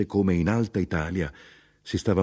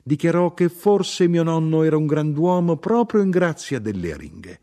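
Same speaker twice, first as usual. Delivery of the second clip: raised fervent narration; 12 semitones higher, 5 dB louder than usual. A narrator's reading. Narration of mother's claim.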